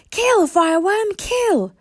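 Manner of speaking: high, loud yelled command; yelling. A narrator's reading. Snoutlout urging his dragon, loud high yell. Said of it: "Snoutlout broke off from yelling".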